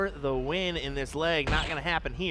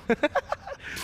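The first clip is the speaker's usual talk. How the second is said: laughing; 12 semitones higher than usual. Real, not acted. Laughing at the story.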